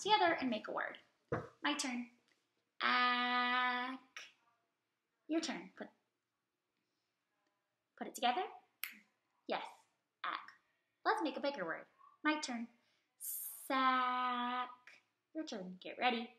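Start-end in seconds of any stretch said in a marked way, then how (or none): 2.8-4.0 Ack stretched out, each sound held and joined, almost sung; teacher modelling blending for kids. Shown as a demonstration. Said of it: "say a word very slowly so you can hear each sound". 13.2-14.7 Sack held long, each sound sung into the next; teacher modelling sounds slowly; stretched sounds. Shown as a demonstration. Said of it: "say a word very slowly so you can hear each sound".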